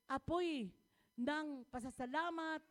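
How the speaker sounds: loud, driving sermon cadence with shouted peaks; full-force preaching. Acted. Pastor rousing the congregation about God's power, loud preaching. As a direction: preach it out to the back row.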